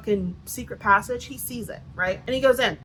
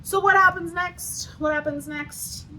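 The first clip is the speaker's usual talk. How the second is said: high, slow sing-song teasing host voice; 8 semitones higher, slower than usual. Pretend. Vlogger teasing viewers about what happens next, high slow sing-song. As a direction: draw it out like a game-show host.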